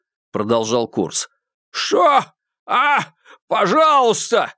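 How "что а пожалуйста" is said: high, frantic pleading voice; desperate frozen man. Acted. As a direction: beg for life, freezing in the river. Malcolm begs from icy water. (отчаянная мольба замерзающего)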